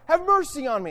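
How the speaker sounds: high, loud plea; shouting out. Acted. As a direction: shout it as a roadside beggar. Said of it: "shouting out".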